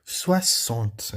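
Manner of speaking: "Soixante" with X said as double S; French pronunciation demo. Shown as a demonstration. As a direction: draw out "Soixante" as swa-sont. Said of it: "mais um som da letra X".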